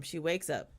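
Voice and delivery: ordinary delivery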